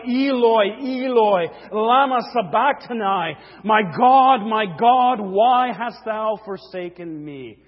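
loud preacher's rendering of an anguished cry; crying out loudly. Acted. Preacher voicing Jesus crying to God from the cross, loud cry. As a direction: call it out, easing on the last phrase. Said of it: "Jesus cried out in a loud voice".

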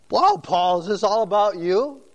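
lightly acted disbelief, lifting into the question; mock incredulous. Reader wondering about Paul's humility, mock incredulous.

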